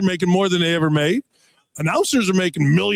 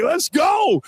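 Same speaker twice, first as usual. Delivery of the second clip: pumped; 12 semitones higher than usual. Retold or imitated. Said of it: "I'm like".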